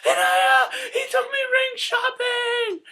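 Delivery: very high, loud mock shriek of joy; screeching, over-the-top excited girlfriend; hammed-up girlfriend squeal. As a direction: shriek the big news in a wildly exaggerated impression. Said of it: "that's a horrible impression".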